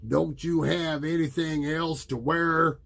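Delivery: mock the kid's sweater; bully voice. A narrator's reading. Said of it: "Russ said to Peter".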